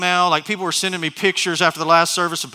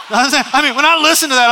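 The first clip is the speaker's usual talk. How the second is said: laughing through the words, higher and louder; 9 semitones higher, 9 dB louder than usual. Real, not acted. Pastor cracking himself up over homegrown tomatoes, laughing. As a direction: break up laughing mid-sentence. Laughing at his own joke.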